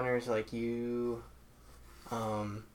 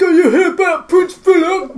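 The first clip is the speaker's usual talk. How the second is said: high, very loud, laughing mock British voice; hammed-up British accent; 19 dB louder than usual. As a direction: caricature a posh Brit, loud and high. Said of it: "I can't do accents".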